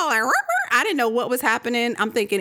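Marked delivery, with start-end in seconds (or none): 0.2-0.7 high growling yip; dog-like growl. Retold or imitated. Imitating the noise outside as a high dog yip. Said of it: "it sounded like a dog".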